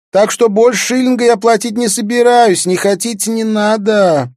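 very high, loud put-on girl's voice; brash ragged girl. Ragged girl bargaining over lesson fees, brash and high-pitched. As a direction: haggle loudly in a piercing high street-girl voice.

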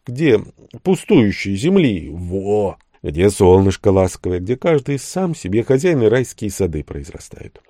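plain reading voice

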